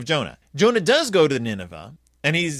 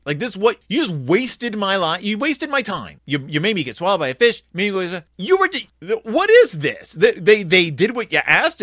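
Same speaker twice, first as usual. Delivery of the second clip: higher, louder indignant complaint; angry rant; 4 semitones higher, 4 dB louder than usual. Retold or imitated. Jonah rants at God for sparing the city, indignant. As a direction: vent at God like he's been cheated. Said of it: "he's like".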